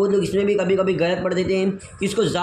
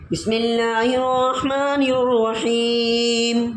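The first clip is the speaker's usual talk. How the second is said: drawn-out, higher, devout recitation; slow recitation; 4 semitones higher, much slower than usual. Real, not acted. Teacher slowly intones the opening blessing before the lesson, devout and drawn out.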